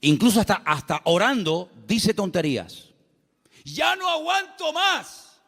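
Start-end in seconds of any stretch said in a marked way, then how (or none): 3.6-5.4 high, slow, whining plea to God; a believer moaning he can't take more; whiny complaint. Retold or imitated. Believer complaining to God in prayer, high slow whine.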